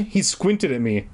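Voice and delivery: normal talking voice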